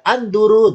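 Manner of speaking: "anduru" with a short du; short vowel. Shown as a demonstration. Teacher introducing the first form, short du. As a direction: say anduru without stretching the du. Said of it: "dotnya pendek".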